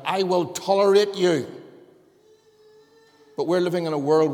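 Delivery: loud, high, hammering pulpit delivery; preacher building to a loud climax; preaching at full force. Acted. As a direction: drive it home to the congregation.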